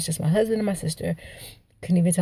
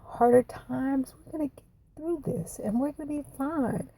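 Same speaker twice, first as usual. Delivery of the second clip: high, soft voice on the edge of tears; real choked-up tenderness; getting emotional; 6 semitones higher, 5 dB quieter than usual. Real, not acted. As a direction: hold back tears while repeating comforting words. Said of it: "I'm about to get emotional".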